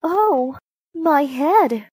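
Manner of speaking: high, pained groan of complaint; moaning. A narrator's reading. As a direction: moan as if your head is throbbing.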